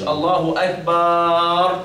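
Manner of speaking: slow "الله أكبر" as akbaaar; added alif after ba. Shown as a demonstration. Teacher showing a grave error, final vowel stretched. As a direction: hold the last a of "أكبر" very long. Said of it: "وليس بعدها ألف".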